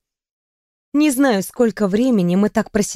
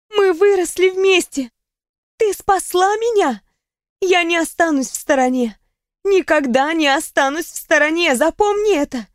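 put-on earnest young woman; 7 semitones higher than usual. A narrator's reading. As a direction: promise it with tears. Riona vows support, tears.

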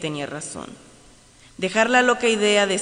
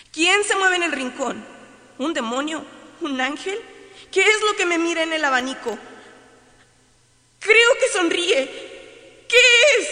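frightened; 9 semitones higher, 5 dB louder than usual. Acted. Frightened questions at night.

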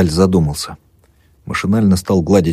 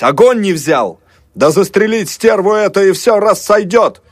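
mob yell; 12 semitones higher than usual. A narrator's reading. Angry crowd demanding to shoot her, high yell. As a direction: rise into a furious crowd cry.

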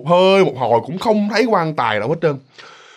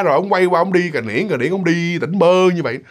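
ordinary talk

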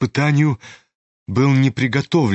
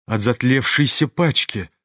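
usual speaking voice

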